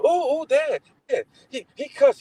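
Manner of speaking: put-on high, whiny tattling girl voice; tattling little sister. Little sister telling dad her brother cussed. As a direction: tell on your brother in a high sing-song. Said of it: "she said".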